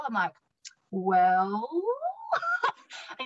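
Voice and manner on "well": "Well." lifted into a rising question; one-word answer ending on a rise. Retold or imitated. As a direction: make "Well." sound unsure and rising. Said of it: "every word I said was, like, a question".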